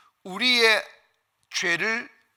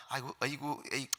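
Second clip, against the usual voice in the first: soft, low scoffing sighs; muted, dismissive tutting under the voice; 6 semitones lower, 12 dB quieter than usual. Acted. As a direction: grumble it off as an unbeliever would. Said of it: "이런 거라고요".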